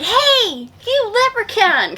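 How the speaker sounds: high mock kid shout; kid calling out. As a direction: yell it like a cocky kid. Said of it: "so he's like".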